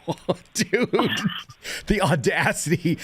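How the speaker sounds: high, laughing disbelief, drawn out at the end; amused mock outrage. Officer to suspect asking to finish his pipe, laughing incredulity. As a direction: retell being floored by the nerve, laughing.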